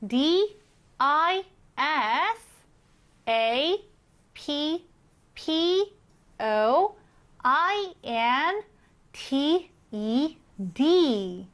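disappointed spelled slowly letter by letter; spelling aloud. Shown as a demonstration. Teacher spelling disappointed slowly for students. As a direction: spell it out, one letter at a time. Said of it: "스펠링을 한번 써보는 연습".

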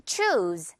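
"choose" with a long, falling oo. Shown as a demonstration. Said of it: "우 발음 길게 끌어주면 돼요".

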